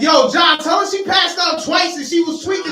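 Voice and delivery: loud, high-pitched cousin imitation; urgent shouting. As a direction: blurt out the alarm at full volume. Cousin urgently reporting what happened.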